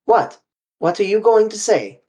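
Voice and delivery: abrupt, urgent interruption in Poirot's voice; sudden sharp query. A narrator's reading. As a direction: spin round and demand an answer. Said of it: "He spun around on me".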